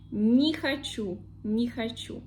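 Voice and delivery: не хочу joined as ni-ha-CHU; one phonetic word. Shown as a demonstration. Teacher showing the unstressed rule, не хочу as ni-ha-CHU. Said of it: "we pronounce it like E".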